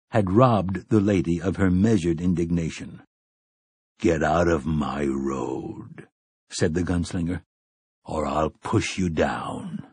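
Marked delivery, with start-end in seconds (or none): 4.0-6.1 slow, low quiet threat; low menacing gunslinger. Acted. Roland threatens the indignant woman. 8.0-9.8 slow, low quiet threat; low menacing gunslinger. Acted. Roland finishes the threat.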